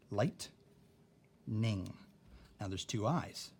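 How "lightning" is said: "lightning" as light, long gap, ning; drawn-out "lightning" in two separate parts; broken into syllables. Shown as a demonstration. Teacher showing lightning's two syllables, slowly split. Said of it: "what we see is".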